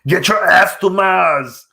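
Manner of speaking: slow, strained Arnold movie line; a rough, slowed Schwarzenegger quote; Schwarzenegger impression. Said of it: "movie with Arnold Schwarzenegger".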